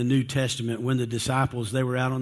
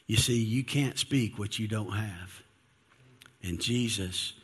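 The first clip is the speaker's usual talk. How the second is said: ordinary talk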